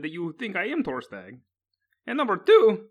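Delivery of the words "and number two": louder, spitting the insult out; angry insult. Acted. Kaveld snaps at the old sailor he thinks betrayed him, loud and angry. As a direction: throw the accusation at him hard.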